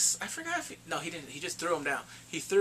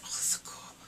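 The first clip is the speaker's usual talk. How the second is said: whispered awe; 4 dB quieter than usual. Retold or imitated. Retelling killer's reaction.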